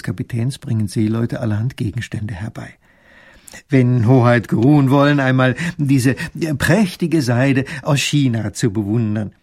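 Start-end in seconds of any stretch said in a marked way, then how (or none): 3.7-9.3 loud, formal captain bowing to his guest; captain courting the little grand duke; ceremonious sea captain. Acted. Captain welcoming the grand duke aboard, ceremonious.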